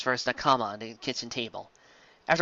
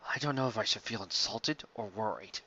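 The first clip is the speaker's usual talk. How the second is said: lowered Sanji voice; 6 semitones lower, 12 dB quieter than usual. Acted.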